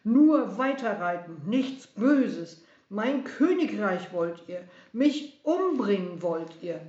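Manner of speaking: loud, accusing royal rant; raging king's loud disbelief; shouted mockery. A narrator's reading. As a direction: throw their words back, loudly. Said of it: "schrie Herodes".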